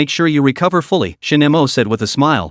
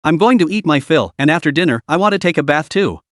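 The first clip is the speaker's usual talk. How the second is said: ordinary delivery, nothing marked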